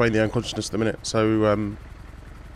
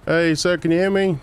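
raised, higher voice to rouse patient; urgent paramedic; 5 semitones higher than usual. Real, not acted. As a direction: call out to wake him.

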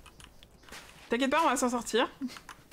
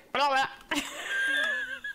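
high delighted giggle; 13 semitones higher than usual. Real, not acted. Delighted by creature's noise.

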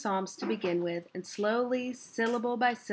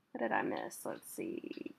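soft unvoiced whisper to self; whispered aside; 13 semitones lower, 8 dB quieter than usual. Real, not acted. Reader checking the page in a whisper. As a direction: whisper it to yourself.

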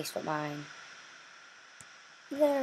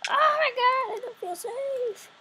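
high, slow, frightened squeal; scared; slower than usual. Acted. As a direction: squeak it out, spooked. Scared in trapped building.

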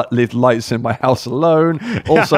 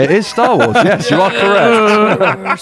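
high slow announcer; 9 semitones higher, 3 dB louder, slower than usual. Pretend. Mock quiz host confirmation.

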